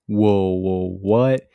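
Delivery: loud, startled double-take; mock shock. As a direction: blurt it out as if caught off guard. Acts out shock at her request.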